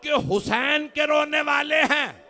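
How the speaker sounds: very slow, high, loud plea; wailing supplication. Acted. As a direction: stretch out each word in grief. Congregation's plea to Syeda. (آہستہ، بلند آواز میں فریاد)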